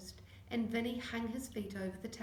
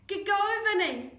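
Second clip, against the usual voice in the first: high, loud cheering yell; 10 semitones higher, 11 dB louder than usual. Acted. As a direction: shout him on. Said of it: "yelled Connor".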